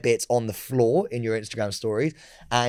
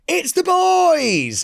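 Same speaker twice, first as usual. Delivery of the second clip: put-on drawn-out, much higher hype shout of the boys; host hype call; 4 dB louder, much slower than usual. Imitating the host announcing them on stage. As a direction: stretch it like a compère hyping the crowd. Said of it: "Roman Kemp's gone".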